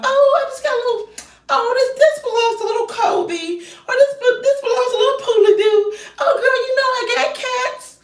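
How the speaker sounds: high, prissy imitation of a cat lady; mocking pet owner. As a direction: play the breezy pet lover brushing it off. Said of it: "the way that they just brush it off".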